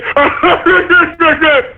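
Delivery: high burst of laughter; real laugh. Real, not acted. Host laughs at listeners thinking he jokes, laughing. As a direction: crack up at your own line. Laughing at his own joke.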